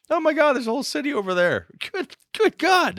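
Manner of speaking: high, mock-amazed exclamation of wonder; mock awe. Host marvels at the lost city in mock awe.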